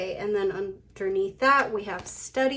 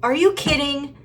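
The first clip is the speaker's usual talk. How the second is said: higher and louder disbelief; real amused disbelief at the card; startled are you kidding; surprised; 5 semitones higher, 8 dB louder than usual. Real, not acted. Card pops out again.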